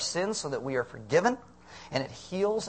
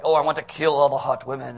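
mock angry male god; 6 dB louder than usual. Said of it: "the next day it's like".

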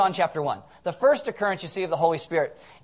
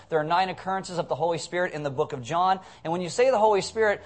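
ordinary talk, nothing marked

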